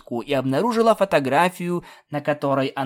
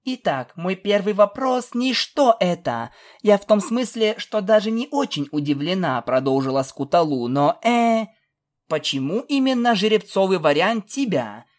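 lightly voiced, higher-pitched filly; puzzled filly; 6 semitones higher, 3 dB louder than usual. A narrator's reading. Filly questions princess about the pony cake, puzzled. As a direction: question in a raised young filly's voice.

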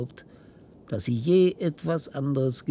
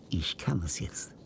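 whisper; 6 semitones lower, 6 dB quieter than usual. Acted. Secret revealed in hushed tone.

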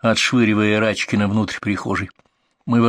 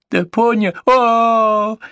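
cry of pain; 13 semitones higher, 4 dB louder, much slower than usual. A narrator's reading. Kicked in the ribs mid-word.

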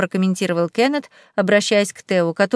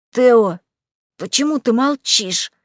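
choked, wheezing squeak; squeezed tight in a fist; strangled voice. Acted. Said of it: "Придушенным голосом просипел мышь".

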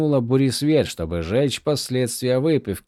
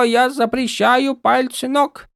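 hammed-up barking of orders; mock drill sergeant; 14 semitones higher, 4 dB louder, slower than usual. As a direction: parody a grumpy sergeant. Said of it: "стала точной копией Каладина". (пародия на сурового сержанта)

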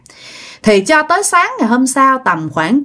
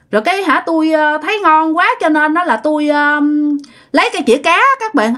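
higher-pitched, brazen and unrepentant; insolent confession; 6 semitones higher, faster than usual. Acted. Neighbor admits to grandma he took her cat, insolent. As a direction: own up cheekily, without any remorse. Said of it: "nhận theo cái kiểu rất là thấy ghét, rất là bố láo".

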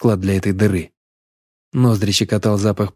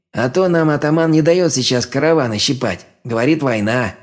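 mocking bandit voice; 4 semitones higher than usual. A narrator's reading. Scar's voice held.

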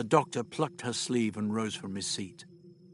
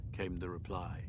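ordinary delivery

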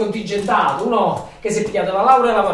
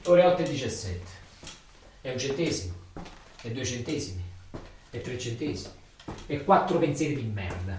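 hushed, low and heavy; low, quiet countdown; 6 semitones lower, 7 dB quieter than usual. Acted. Ritornello cupo del turno. (conto alla rovescia sommesso e cupo)

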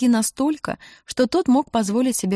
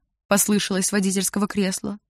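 plain reading voice

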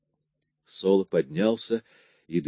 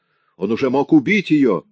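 louder, higher angry outburst; furious shout; 6 semitones higher, 6 dB louder than usual. Acted. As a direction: shout it in desperate rage. Solo furious about daughter.

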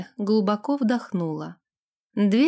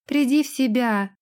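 dry order; 3 semitones higher than usual. Acted. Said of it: "сухо приказала она".

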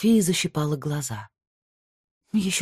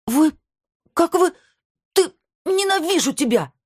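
high furious scream of hate; furious shout; 13 semitones higher, 3 dB louder than usual. A narrator's reading. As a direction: scream it at him, shaking with rage. She attacks him in rage.

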